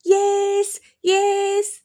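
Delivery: high, loud sing-song yelling; sing-song shout. Acted. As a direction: chant it loud into her ear. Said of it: "గట్టిగా అరవడం మొదలుపెట్టారు".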